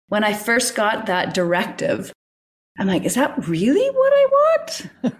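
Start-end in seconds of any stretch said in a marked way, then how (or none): 3.0-5.1 high, slow, laughing disbelief; incredulous laughing question. Retold or imitated. Doubting the order to close her business, laughing disbelief. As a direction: ask it as if it can't be true.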